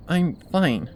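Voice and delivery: terse, clipped reply; curt. A narrator's reading. Twilight lying curtly to her worried friends. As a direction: shut the question down. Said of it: "far more curt than I intended".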